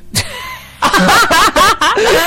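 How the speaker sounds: high loud burst of laughter. Real, not acted. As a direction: cracks up loudly. Big laugh.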